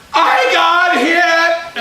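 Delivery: slow, high, loud whine; hammed-up cry of an injured kid; pained wail. Hurt boy tells his grandmother, wailing in pain.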